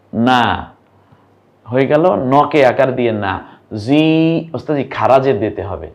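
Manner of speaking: ordinary talk, nothing marked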